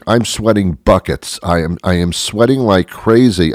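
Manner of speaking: ordinary talk, nothing marked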